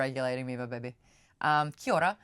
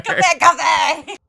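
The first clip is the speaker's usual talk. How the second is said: put-on French accent; 10 semitones higher, 13 dB louder, slower than usual. Mock French words.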